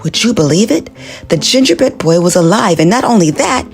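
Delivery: plain reading voice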